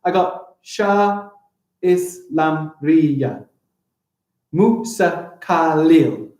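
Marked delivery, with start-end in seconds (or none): 0.6-3.4 very slow "Shah Islam Riya" with gaps between beats; measured, separated syllables. Shown as a demonstration. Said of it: "Syllables are the beats in a word". 4.5-6.3 slow "Musa Khalil" in four separate beats; even, deliberate beats on "Musa Khalil"; syllable by syllable. Shown as a demonstration. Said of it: "Four syllables".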